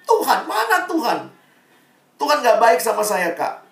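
high-pitched, imitated teenage outcry; mock indignant wail, pitched well up; retold angry protest. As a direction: call God out like an aggrieved teenager. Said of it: "Dia datang marah-marah".

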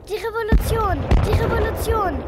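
high anxious repetition; alarmed. Acted. Frightened woman telling children of the revolution, anxious cry. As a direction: cry it out in dread.

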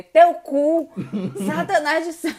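high, loud mock-theatrical insults; mimicking exaggerated intonation. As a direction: imitate someone hurling insults with relish, laughing. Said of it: "Toda vez que você vai falar o xingamento, você usa". (imitando xingamento com entonação exagerada)

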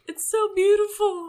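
high, loud, teary gush; mock ugly crying. Telling friends how she wept at the applause, in a high teary gush. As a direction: sob it out, overwhelmed and hamming it up. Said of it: "I'm ugly crying at this point".

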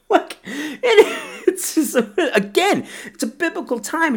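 high-pitched, breaking up with real laughter; laughing while talking. Real, not acted. Film fan after quoting a favorite line, laughing. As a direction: struggle to get the words out through laughs.

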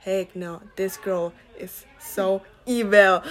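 slow, quiet, low-pitched inner verdict; mock-dramatic evil judgment. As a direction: mutter it darkly, slowly, to yourself. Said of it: "I was like".

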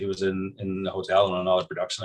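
ordinary talk, nothing marked

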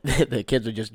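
usual speaking voice

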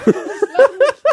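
high burst of laughter; loud laugh. Real, not acted. Burst of laughter after realization.

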